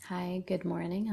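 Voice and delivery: ordinary delivery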